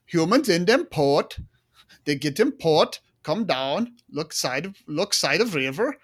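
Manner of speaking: Scandinavian accent impression. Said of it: "Kihar was like".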